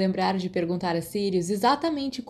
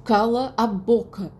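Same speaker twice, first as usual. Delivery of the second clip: slow, louder growled shut up; furious boy telling his friend to shut up; angry grunt; 3 semitones higher, 5 dB louder, slower than usual. Acted. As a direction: spit it out through anger. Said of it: "ele grunhiu".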